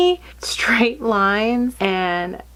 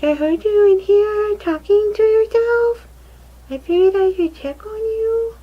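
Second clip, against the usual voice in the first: put-on much higher, louder child's voice; imitating daughter; 12 semitones higher, 5 dB louder than usual. Mother voicing her daughter who came to check on her, high child voice. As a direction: play the little girl checking on mom. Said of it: "She's like".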